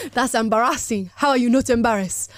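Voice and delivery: put-on lightly Nigerian-accented read; a hint of Nigerian accent. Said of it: "Gotta bring out my Nigerian accent for that".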